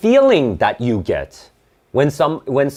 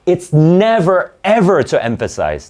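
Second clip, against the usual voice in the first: teacher flatly correcting a common mistake, punching never; stress on NEVER; 7 semitones higher, 4 dB louder than usual. Real, not acted. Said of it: "teacher insists, emphatic correction".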